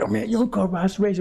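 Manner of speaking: a hint of an offended patriot; mock objecting patriot. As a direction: mimic the guy protesting the insult. Voicing a patriot who objects.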